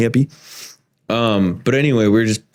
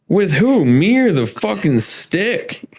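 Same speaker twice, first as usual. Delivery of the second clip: higher, slowed incredulous retort; mock disbelief; 7 semitones higher, slower than usual. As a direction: ask it like he can't believe it, higher and slow.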